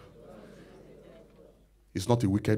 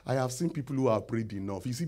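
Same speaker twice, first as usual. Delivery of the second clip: ordinary talk, nothing marked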